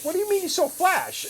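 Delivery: high-pitched retold disbelief; incredulous. Tattooist retelling friend's boast of selling flash, incredulous.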